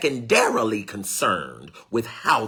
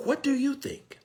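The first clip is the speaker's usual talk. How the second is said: asking gently, lightly voiced high; mature person asking; 4 dB quieter than usual. Preacher voicing a mature adult asking the listener, lightly high. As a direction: offer the question, a hint of an open listener. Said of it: "If they never ask".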